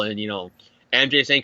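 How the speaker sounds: ordinary talk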